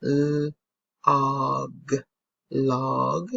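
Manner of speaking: "l-, og, log" split then blended; sounding out a word. Shown as a demonstration. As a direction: segment "log" for the children. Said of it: "If we did log".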